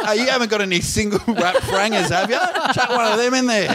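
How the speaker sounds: high, laughing put-on voice; cheeky mock voice. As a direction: play it cheeky and hammed-up. Joking shop request, acted quote.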